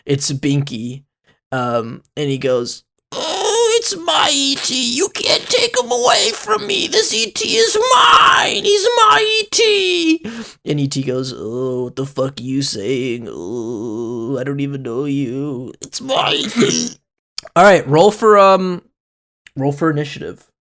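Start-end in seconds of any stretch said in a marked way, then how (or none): 3.1-10.2 very high, crying shout, slow; wailing giant baby. Acted. The giant baby claiming ET, high and bawling. 11.3-16.9 soft and slow, sneering at the end; irritated alien reply. Acted. ET answering the baby, softly annoyed.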